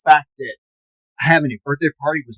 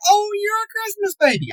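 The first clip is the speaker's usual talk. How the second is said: put-on much higher, sing-song oh you're a Christmas baby; mock cutesy voice. Cashier checking ID, cooing in a high sing-song. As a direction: coo it like an amused cashier. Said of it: "they'll go like".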